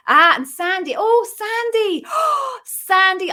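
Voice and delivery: high, loud squeal of recognition with a gasp; thrilled greeting. Real, not acted. Host spotting a favourite viewer has joined, thrilled surprise. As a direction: gasp and cry out the name in delight.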